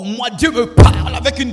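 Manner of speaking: loud, high swaggering boast; mock boaster. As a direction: blare it out to show off. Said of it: "c'est parce que tu es sourd".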